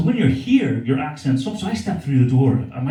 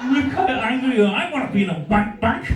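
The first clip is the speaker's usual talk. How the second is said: higher, laughing through it; laughing tease; 9 semitones higher than usual. Acted. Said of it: "you're fucking furious".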